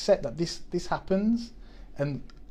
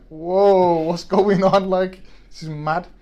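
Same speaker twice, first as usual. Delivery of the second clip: higher and much louder, stunned; shocked disbelief; 7 semitones higher, 12 dB louder, much slower than usual. Retold or imitated. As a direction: blurt it out, floored by what just happened. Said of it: "I'm just like".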